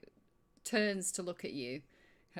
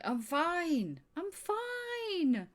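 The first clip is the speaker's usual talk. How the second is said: put-on high, loud drunken protest; drunk insistence; 12 semitones higher, 5 dB louder, much slower than usual. Drunk guard insists to barmaid he's fine, high and loud. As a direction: slur that you're fine. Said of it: "He's like".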